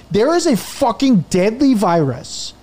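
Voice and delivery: high, slow, emphatic objection; re-enacted exasperated rant; exasperated protest. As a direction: spell it out, outraged, to the phone agent. Retelling his outburst at phone company.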